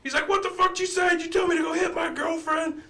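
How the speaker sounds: high, loud mock outraged shout; furious drunk yell. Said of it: "the dude just flew off the goddamn handle".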